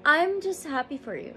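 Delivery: high, overcome with emotion; emotional. Real, not acted. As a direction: voice lifted high by real emotion. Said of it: "I am so emotional right now".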